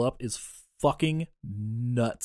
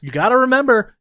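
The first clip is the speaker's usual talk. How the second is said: excited reminder; 10 semitones higher, 9 dB louder than usual. Real, not acted.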